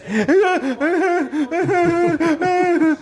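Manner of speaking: long, high imitated wail; high mock wail. Imitating the film kid when he lands, high mock wail. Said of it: "he's like that".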